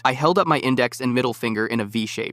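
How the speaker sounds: normal talking voice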